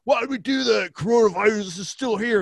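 high, loud exasperated mimicry; mock public outcry. Mimicking everybody complaining about the shutdown, loud mock outrage.

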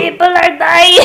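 put-on high, loud old-witch take on Trump. Friend mocking Trump in a loud witchy voice. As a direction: screech it like a cackling witch doing Trump. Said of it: "She sounds like an old witch".

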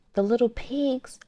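lightly voiced wolf, coaxing; growling wolf. A narrator's reading. Wolf growling at the stick house door, lightly voiced. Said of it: "he growled".

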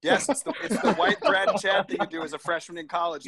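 laughing. Real, not acted. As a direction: laugh as you say it. Laughing at own story.

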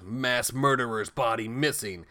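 slow, emphatic mock headline read; drawn-out, portentous headline voice; newspaper headline. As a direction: announce it word by word like bold print. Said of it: "headline on the front page in big, bold letters".

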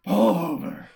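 loud urgent command; shouting. Retold or imitated. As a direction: yell it at the driver. Batman orders the car stopped.

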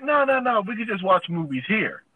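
quickly head off the suggestion, playing it innocent; hasty deflection. Retelling his hasty deflection of date.

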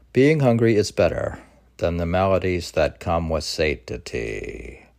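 loud, measured reading of a poem. Acted. Reading Rumi's poem on fasting to his friend, declaiming loudly. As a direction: read the Rumi verse out to the listener like a recital. Reads Rumi poem aloud.

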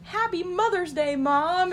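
high, bright singsong greeting; child's message on a pillow for mom, singsong; singsong kid card. Retold or imitated. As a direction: read it like a kid's card.

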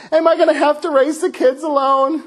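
high, frantic plea of a worried wife; hammed-up wife praying in a panic; mock panicked wife. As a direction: play the wife begging God. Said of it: "Rachel's probably freaking out, like".

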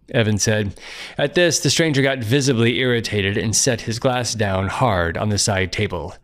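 ordinary delivery

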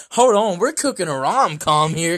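hyped Hollywood producer; excited. Pretend. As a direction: shout it like a big pitch.

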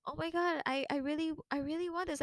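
high, eager gasping; so excited. Retold or imitated. Reliving her excitement on seeing the box, high and eager. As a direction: beg for it in delight. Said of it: "And I was so excited".

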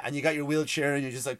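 usual speaking voice

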